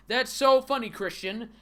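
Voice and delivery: high, loud fake delight; mock amusement.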